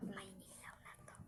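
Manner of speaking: hushed whisper. Real, not acted. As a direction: whisper so you're not found. Whispering while hiding.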